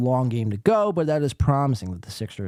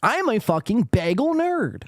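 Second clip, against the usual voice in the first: raised, mocking nerd voice; mock nerd line; 9 semitones higher than usual. Streamer mocking the guest's line in a raised voice. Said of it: "That's what he should have said".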